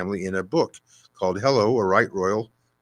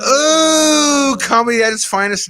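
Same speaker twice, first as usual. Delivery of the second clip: sarcastic; 10 dB louder, slower than usual. Acted. Sarcastic reaction to pun.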